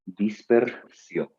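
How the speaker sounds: dispersión said slowly, ending falling. Shown as a demonstration. Teacher giving the correct form to students, slowly. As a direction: give the answer dispersión slowly. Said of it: "te voy a decir".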